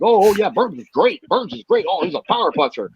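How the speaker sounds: sarcastic fanboy gush, high and mocking; mock fawning fan. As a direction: ham up a fickle fan suddenly singing his praises. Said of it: "being like".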